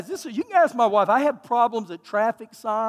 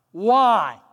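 ordinary talk, nothing marked

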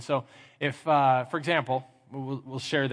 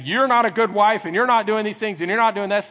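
raised, louder acted scolding; yelling at wife; 6 semitones higher, 7 dB louder than usual. Frustrated husband to his wife, yelling. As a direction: hurl a list of faults at her. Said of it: "Now I'm yelling at her".

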